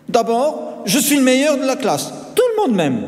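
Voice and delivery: high, insistent schoolboy brag; boastful boy. A narrator's reading.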